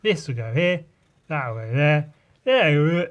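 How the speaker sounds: excited planning. Acted. Acts out placing ideas excitedly.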